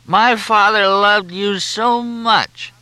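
very high, drawn-out loud wail; tearful whine. A narrator's reading. Junior pleads with his father's favourite, whining tearfully. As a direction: wail it slowly through tears. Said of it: "Suddenly, no tears, no whining voice".

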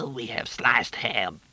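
whispered through coughing; hoarse whisper. A narrator's reading. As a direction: rasp it out after a cough. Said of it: "coughed until her eyes ran".

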